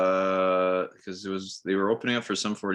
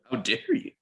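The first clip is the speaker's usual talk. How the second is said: higher-pitched playful indignation; mock outrage; 10 semitones higher than usual. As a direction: feign being scandalised, voice lifted. Mock reaction to disliked band.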